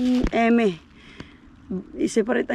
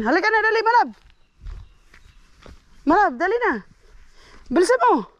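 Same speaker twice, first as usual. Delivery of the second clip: loud high call to the dog; 11 semitones higher, 4 dB louder than usual. Shown as a demonstration. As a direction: urge the dog to hurry, loudly. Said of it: "Isang sigaw ko lang".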